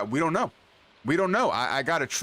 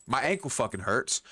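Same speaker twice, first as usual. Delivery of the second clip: normal talking voice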